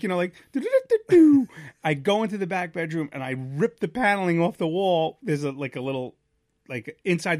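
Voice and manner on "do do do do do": high, loud "do-do-do" run; cartoon scramble noise. Man sliding across wet basement, loud cartoon scramble noise. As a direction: sing out a comic dashing sound effect.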